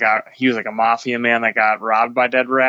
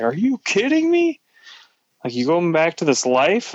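high-pitched mock outrage, not shouted; retold fury; 6 semitones higher than usual. Girlfriend confronting him about going back to crime, mock furious. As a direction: imitate an incredulous, furious girlfriend, pitch jumping up.

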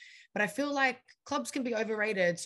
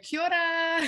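kia ora called out high and louder; excited greeting; 11 semitones higher, 7 dB louder than usual. Pretend. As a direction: greet the whole festival crowd with a bright kia ora. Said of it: "next year I'll be like".

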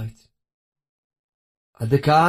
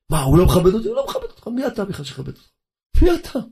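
mock indignation; 6 semitones higher, faster than usual. Mocks an offended proud man.

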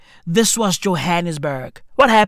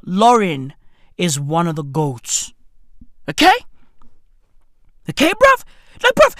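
ordinary talk

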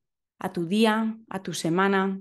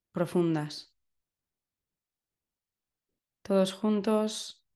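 guided meditation. Acted. Leads a breathing meditation.

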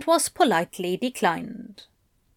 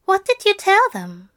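high, urgent angel voice; anxious angel; 10 semitones higher, 4 dB louder than usual. A narrator's reading. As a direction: question urgently, pitched well up. Angel anxiously questions demon.

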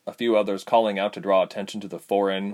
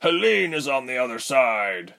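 raised, higher pleading voice; urgent cry; 4 semitones higher than usual. A narrator's reading. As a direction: press the urgency, voice lifted. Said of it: "Jonin cried".

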